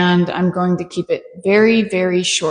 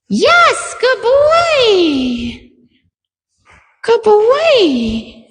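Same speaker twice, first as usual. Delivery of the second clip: much higher, louder dog praise; 13 semitones higher, 4 dB louder, much slower than usual. Real, not acted. Trainer rewards the dog on the touchpad, high excited praise.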